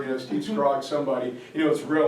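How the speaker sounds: ordinary talk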